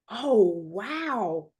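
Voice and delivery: mildly awed gasp, read aloud; a touch of amazement. A narrator's reading. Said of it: "Tammy gasped".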